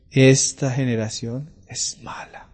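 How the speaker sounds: whispered and slow; preacher whispering the Lord's rebuke; hushed. Acted. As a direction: breathe the words as a grave secret. Hushed quote of the Lord's words.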